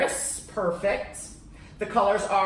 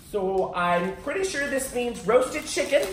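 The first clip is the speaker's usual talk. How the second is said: usual speaking voice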